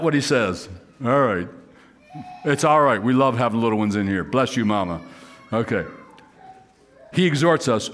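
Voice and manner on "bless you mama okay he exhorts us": ordinary delivery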